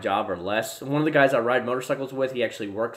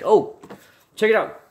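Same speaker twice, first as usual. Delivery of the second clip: high, loud check it out; eager to show the viewers; excited; 10 semitones higher, 7 dB louder than usual. Real, not acted. Streamer shows viewers his gear, high and excited. Showing something on camera.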